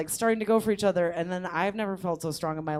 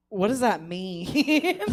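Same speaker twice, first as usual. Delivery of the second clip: confused; 4 dB louder, much slower than usual. Retold or imitated. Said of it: "And I was like".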